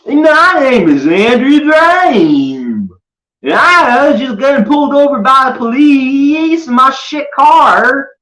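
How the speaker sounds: very high, slow, loud whining voice; mocking Andrew. Mocking Andrew pulled over by police, high slow wail. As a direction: stretch each word into a high loud wail.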